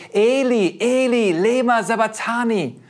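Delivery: loud, high cry of anguish; loud, high desperate cry, slowed at the end. Retold or imitated. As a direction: cry it out to the heavens, dragging the last words. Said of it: "Und er schrie".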